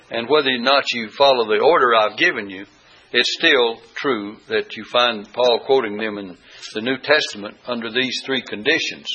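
ordinary delivery

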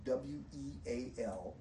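spelling "W E A L" for the class; spelled out. Shown as a demonstration. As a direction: spell "W E A L" letter by letter, plainly. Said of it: "messages of weal".

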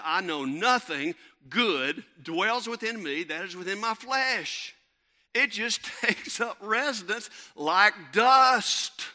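loud, slow, emphatic preacher's proclamation; full-force preaching. Real, not acted. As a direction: hammer the point home, slowly and loudly.

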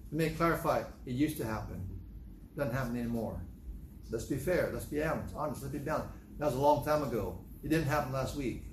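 ordinary talk, nothing marked

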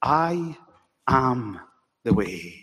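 I, am, the way, each word spaced with pauses and dropping in pitch; measured, deliberate repetition; said slowly again. Acted. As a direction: say it again word by word, falling to a low close. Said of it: "Now I'm gonna say it again".

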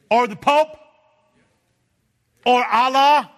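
loud, high fervent preaching; full-force preaching. Real, not acted. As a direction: lift the whole room with it.

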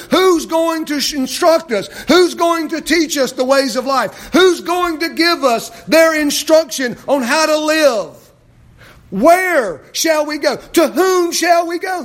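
loud, high preaching cry; fiery preaching. Acted. Pastor urging the congregation, preaching loud and high. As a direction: preach it out with full force.

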